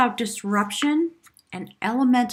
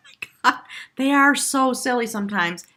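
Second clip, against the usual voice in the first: squealing high and loud; gushing excitement; 3 semitones higher, 6 dB louder than usual. Acted. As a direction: burst out delighted, loud. Gushing over spirit guides.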